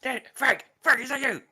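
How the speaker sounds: high, frantic pirate crying out to Frank; desperate pirate. Acted. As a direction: call out from inside the gem, panicked. Trapped pirate calls out.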